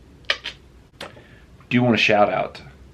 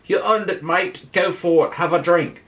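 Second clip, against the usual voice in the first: put-on a botched Irish accent that drifts Australian, pitched much higher; Conor McGregor impression; 9 semitones higher than usual. McGregor toasting the vlogger's thousand subscribers, in a botched Irish accent. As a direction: hand over the whiskey as a cocky Irish fighter, badly accented. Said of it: "That probably sounded more Australian than Irish".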